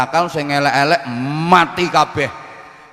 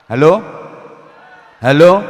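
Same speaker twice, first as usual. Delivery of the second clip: checking the mic, loud rising hello; loud calling; 3 semitones higher, 9 dB louder than usual. Real, not acted. Preacher checks the crowd can hear, calling out loud. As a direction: call out to the hall.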